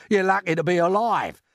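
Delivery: high, loud reproach; grumpy earthworm's irritated retort; cross scolding. A narrator's reading. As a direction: tell off an ungrateful friend.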